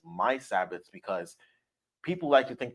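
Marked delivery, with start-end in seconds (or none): 0.1-0.9 "my" stressed, "Sabbaths" lighter. Shown as a demonstration. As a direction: hit "my" in "my Sabbaths". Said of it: "I put emphasis where it says".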